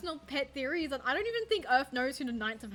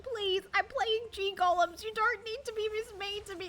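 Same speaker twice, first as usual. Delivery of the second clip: hammed-up whimper of a bullied player; mock crying plea; 10 semitones higher, 5 dB louder than usual. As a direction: beg in a high mock-sob voice.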